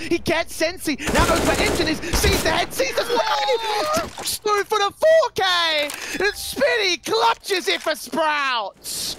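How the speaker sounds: building to a screaming hype call; excited shout. Real, not acted. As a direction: yell the clutch climax. Caster hype on the clutch.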